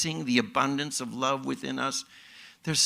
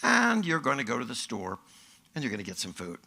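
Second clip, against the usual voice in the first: usual speaking voice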